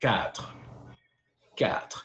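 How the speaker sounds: "Quatre" with a guttural final R; throaty French R. Shown as a demonstration. Teaching four in French, throaty R. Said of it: "It's like pronouncing the egg".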